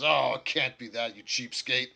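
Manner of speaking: a touch of a squabbling orc; lightly voiced orc. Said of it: "the orc says".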